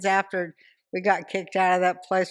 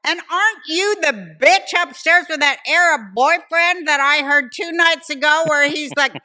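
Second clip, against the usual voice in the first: loud, high retold accusation; confrontational; 9 semitones higher, 7 dB louder than usual. Mother turning on upstairs neighbour, loud accusation. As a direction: go on the attack at full voice.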